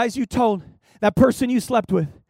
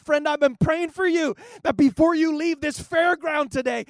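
rising to a loud preaching climax, faster at the end; preaching at full force; 4 semitones higher, 5 dB louder than usual. Acted. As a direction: build the altar call until you're nearly shouting.

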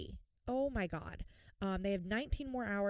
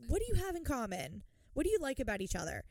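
rushed quote; 5 semitones higher, faster than usual. Retold or imitated. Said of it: "And they were like".

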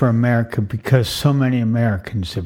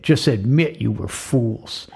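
normal talking voice

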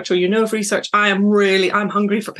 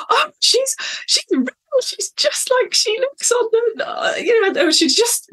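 gushing, much higher, almost squealing; mega fangirl moment. Retold or imitated. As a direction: starstruck fan losing it over meeting her idol. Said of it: "I really had this mega fangirl moment".

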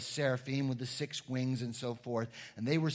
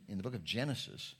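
ordinary talk, nothing marked

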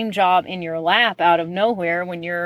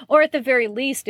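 normal talking voice

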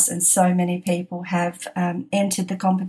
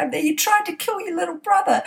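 mock accusation; 11 semitones higher than usual. Mock accusing daughter on phone.

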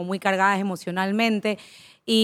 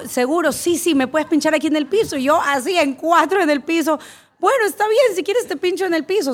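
much higher and louder, hammed-up eager yes; playfully giving in to an absurd request, pitched way up; mock eager agreement; 8 semitones higher, 3 dB louder than usual. Se imita cediendo a la paciente. (aceptación exagerada, mucho más aguda y fuerte)

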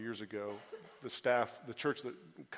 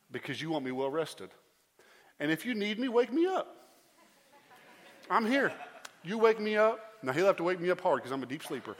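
louder and much higher, fervent; full-force preaching; 7 semitones higher, 8 dB louder than usual. Acted. Preacher fervently telling the congregation about his bedtime prayer. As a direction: preach it, voice soaring high.

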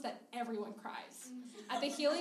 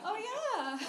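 high, louder token agreement; awkward agreement; 10 semitones higher, 5 dB louder than usual. Acted. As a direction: chime in awkwardly, pitched way up. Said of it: "peppering in one-word agreements".

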